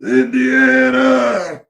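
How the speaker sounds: high, loud, slow shriek of Indiana; the angry creature screaming at him. Retold or imitated. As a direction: shriek the name in a strange voice, drawn out. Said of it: "just screaming".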